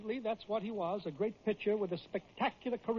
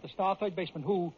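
ordinary delivery, nothing marked

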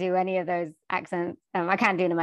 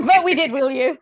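higher, louder, laughing tease; laughing admission; 6 semitones higher, 7 dB louder than usual. Real, not acted. Brit teasing an American about ruling them, laughing. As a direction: say it through rising laughter.

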